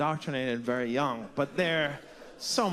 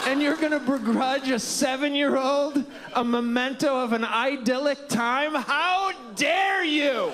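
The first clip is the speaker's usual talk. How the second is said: mock indignation; 7 semitones higher than usual. Defending kids' baseball to the crowd, hammed-up indignation. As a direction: play the wounded defender of kids.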